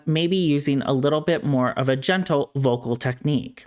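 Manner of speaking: ordinary talk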